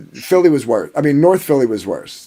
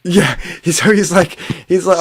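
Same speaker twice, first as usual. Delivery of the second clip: ordinary talk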